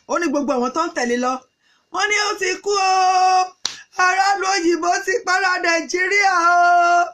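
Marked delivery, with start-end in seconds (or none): 1.9-7.0 high, loud wailing announcement; raised alarm. Acted. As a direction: cry out the terrible news to the street. Acted alarm spreading news of death.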